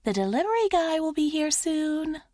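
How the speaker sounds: high, lilting sing-song announcement; sing-songy. Acted. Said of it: "announces in a sing-songy voice".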